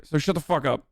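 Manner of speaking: normal talking voice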